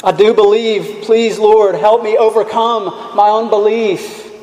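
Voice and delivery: pleading cry. Retold or imitated. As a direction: beg the Lord for help.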